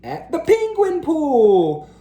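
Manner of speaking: high, loud excited reveal; excited build. A narrator's reading. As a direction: build to the big moment. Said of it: "cheered Splat".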